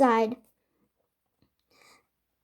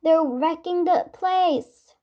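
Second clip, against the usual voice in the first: worried; 6 semitones higher, slower than usual. A narrator's reading. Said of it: "Kirsty exclaimed".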